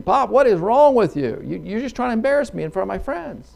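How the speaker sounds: high, emphatic son's complaint; exasperated. Acted. Embarrassed son to his hollering father, exasperated.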